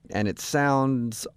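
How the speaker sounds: SOUNDS drawn out very slowly; stress on SOUNDS. Real, not acted. Host hinting at a date's awkward ending, SOUNDS stressed. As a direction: lean hard on "sounds" and stretch it. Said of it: "Emphasis on sounds".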